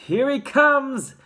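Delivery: high, loud, drawn-out yell; excited showman's call. A narrator's reading. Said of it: "yelled Mister Wonka".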